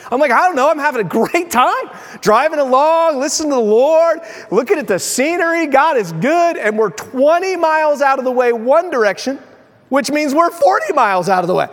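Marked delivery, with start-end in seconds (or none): 0.3-6.6 high, singsong cheerful obliviousness; blithely oblivious. Retold or imitated. Lost husband answering his wife, high and blissfully carefree. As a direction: play the happily lost driver, pitched up. Oblivious driver enjoying himself, retold.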